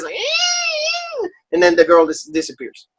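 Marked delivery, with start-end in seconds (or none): 0.1-1.3 very high, thin squeaks; squeaky puppet noise. Retold or imitated. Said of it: "like, the squeaky noises".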